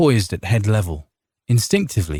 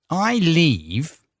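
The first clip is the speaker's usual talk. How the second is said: high, amused mock disbelief; wry; 9 semitones higher than usual. A narrator's reading. Count mocking the farmer's demand, wry. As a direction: smile through the question. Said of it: "Count Algier said with a wry smile".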